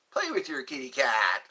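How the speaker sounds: normal talking voice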